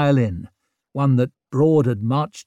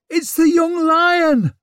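high loud crowd shout; calling out; 5 dB louder than usual. A narrator's reading. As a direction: cry out to the crowd. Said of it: "someone called".

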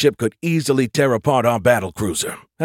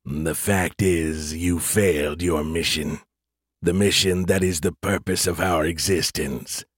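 low, slow machine delivery; robotic voice; 5 semitones lower, 3 dB quieter, slower than usual. A narrator's reading. Elder AI accuses Skippy of failing, robotic. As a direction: intone it evenly like a stern computer. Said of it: "came a robotic voice".

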